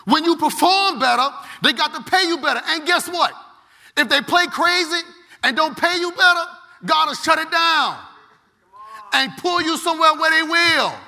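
high-pitched, fired-up preaching; hollering preacher. Real, not acted. Preacher promising the congregation God will step in, hollering. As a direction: holler it out with held line endings. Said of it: "I'm screaming. I'm hollering".

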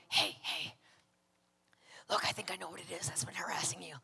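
low, hushed whisper; urgent whisper. Retold or imitated. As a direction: lean over and whisper it secretly. Leaning over during the meeting.

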